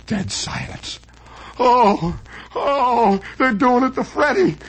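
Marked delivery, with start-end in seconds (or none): 0.1-1.0 whispered. Acted. Whispered deadpan after flop.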